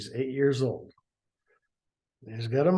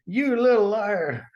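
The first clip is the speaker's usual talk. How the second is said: high, slow, hammed-up sneer at the daughter; mock menacing mother voice; mother accusing; 8 semitones higher, 4 dB louder than usual. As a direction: drag out the insult like a mad mom. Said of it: "Missus Haynes said".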